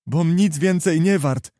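put-on wretched young man crying out, moderately; unhappy outcry. A narrator's reading. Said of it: "zakrzyknął nieszczęśliwy młodzian". (żałosny okrzyk)

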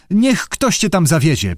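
ordinary delivery, nothing marked

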